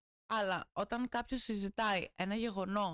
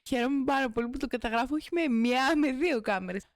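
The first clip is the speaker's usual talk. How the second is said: louder, mock-cheerful boast; sarcastic loud; 2 semitones higher, 8 dB louder than usual. Real, not acted. Mock-proud to viewers about two cameras, loud. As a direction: brag in loud mock delight.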